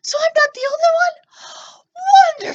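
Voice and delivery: very high, slow, teary-relieved squeal; little boy's high, slow, quavering relief. Acted. Puppet relieved, near crying.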